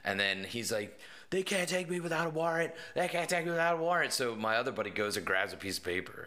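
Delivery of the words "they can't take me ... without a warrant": high, insistent mock whine, higher pitched; protesting kid. Kid insists to the officers they need a warrant, high and stubborn.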